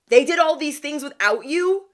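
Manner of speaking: husband upset on his wife's behalf, sounding outraged. Retold or imitated. Husband on Facebook, upset she was left out, outraged. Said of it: "Like, he was mad".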